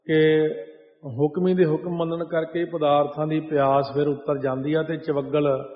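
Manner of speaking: normal talking voice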